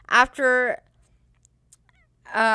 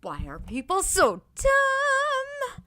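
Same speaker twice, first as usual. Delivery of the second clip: exasperated; 13 semitones higher, slower than usual. Real, not acted. After the rant.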